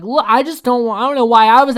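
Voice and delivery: very high, loud imitation of complaining; hammed-up aggrieved excuse; mock defensive protest. Acted quarrel, Eliza protests back.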